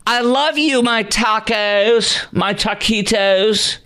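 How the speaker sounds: very high, slow, gushing voice; mocking Jill Biden. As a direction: stretch it out high and saccharine. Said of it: "Jill Biden's".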